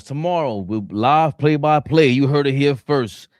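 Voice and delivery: loud, high show-promo delivery; announcer hype. Pretend. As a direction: proclaim it like an announcer selling tomorrow's show. Hyping tomorrow's live show like announcer.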